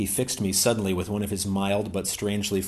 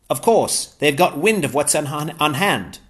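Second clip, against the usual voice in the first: higher-pitched, eager English gentleman; Ransom's voice; 6 semitones higher, 6 dB louder than usual. Acted. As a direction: pitch Ransom up, glad and keen.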